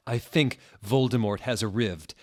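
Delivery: ordinary delivery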